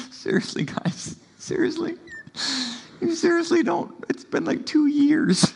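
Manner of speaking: mock whimper, breathy then jumping high; hammed-up pleading with his disciples; half-whispered mock despair with high squeaks; whimpering Jesus. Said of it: "with a whimper in his voice".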